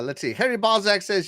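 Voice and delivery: ordinary talk, nothing marked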